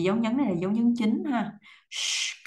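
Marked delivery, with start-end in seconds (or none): 1.9-2.5 "Sh" hissed with curled tongue and rounded lips. Shown as a demonstration. Teacher demonstrating the consonant, curled-tongue "Sh". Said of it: "cong lưỡi tròn môi".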